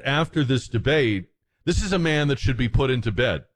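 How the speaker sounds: normal talking voice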